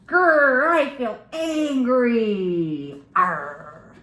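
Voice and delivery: loud slow mock growl; hammed-up angry growl, drawn out; pretend angry. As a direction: play an angry monster for kids. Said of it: "pretend to be angry".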